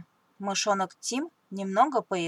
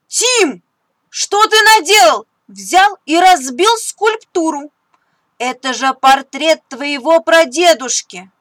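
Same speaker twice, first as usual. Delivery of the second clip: higher, louder furious scolding; angry mother; 7 semitones higher, 13 dB louder than usual. Acted. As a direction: scold him hard over the broken statue. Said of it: "Мама очень сильно разозлилась". (мама сердито отчитывает сына)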